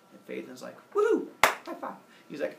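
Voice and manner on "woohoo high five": high, loud celebratory cheer; whoop, then offer it eagerly; excited whoop. Acted. Son granted any wish, whooping with excitement. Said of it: "He's all excited".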